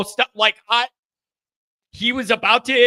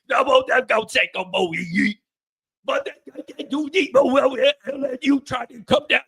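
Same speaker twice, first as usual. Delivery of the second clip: thick, mumbled Louisiana football coach voice; 3 semitones higher than usual. Acted. Host doing a requested coach impression about spring break, mumbled Cajun voice. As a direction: ramble like a Cajun coach, words running together. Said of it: "you like the Coach O too".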